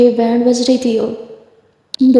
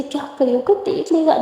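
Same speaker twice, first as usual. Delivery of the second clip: softer, hushed words so nobody hears; hushed in class; 2 semitones higher, 6 dB quieter than usual. Acted. Simran hushing Durga at the back of class, softer and hushed. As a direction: keep it down, a nervous hush.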